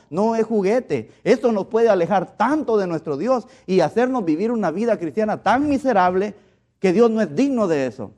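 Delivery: loud, high-pitched pulpit preaching with heavy pauses; fervent preaching. Acted. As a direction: preach it hard to the congregation. Preaches at full force.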